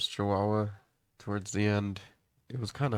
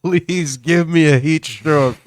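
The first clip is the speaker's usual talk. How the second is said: slow, louder, higher mock begging; dog's thoughts; 7 semitones higher, 15 dB louder, slower than usual. Dog sunbathing on hot concrete, slow loud mock plea. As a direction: ham up the dog's plea to the sun. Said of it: "This is what's going through his head".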